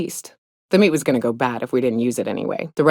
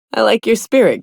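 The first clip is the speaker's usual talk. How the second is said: chuckling, pitched up and amused; laughing; 8 semitones higher, 4 dB louder than usual. Real, not acted. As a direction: laugh through the compliment. Amused praise for the captain.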